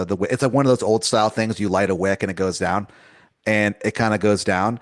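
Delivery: usual speaking voice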